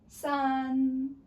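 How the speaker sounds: "san" held loud, high and level; high flat tone. Shown as a demonstration. Teacher counts together with pupils, high flat tone. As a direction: keep "san" up on one note. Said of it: "keep at that pitch".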